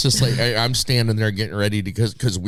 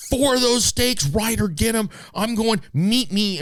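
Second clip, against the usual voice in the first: urgent orders; 13 semitones higher, slower than usual. Retold or imitated. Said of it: "I go".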